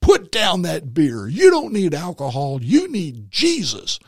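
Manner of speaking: lampoon a judgmental street preacher; finger-pointing preacher. Retold or imitated. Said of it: "point fingers and go".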